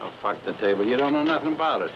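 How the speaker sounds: loud, fast, furious snap; angry outburst. Acted. Argument over price and guns.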